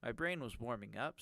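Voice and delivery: ordinary talk, nothing marked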